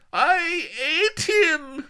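very high, loud, slow crying fury; shrieking sobbing rage; spat with hatred. Acted. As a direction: spit it out through tears. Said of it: "she spat".

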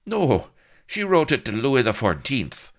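loud, high sarcastic retort; snarl. A narrator's reading. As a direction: snap back in pained irritation. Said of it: "snarled Blood".

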